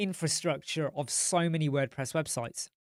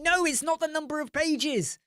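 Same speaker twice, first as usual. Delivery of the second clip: exasperated explaining; 12 semitones higher than usual. Retold or imitated. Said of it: "she'll be like".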